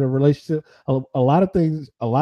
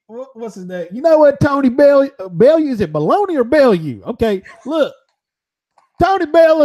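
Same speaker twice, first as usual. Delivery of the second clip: Butterbean voice; 9 semitones higher, 4 dB louder than usual. Retold or imitated. Butterbean voice mocks boxer.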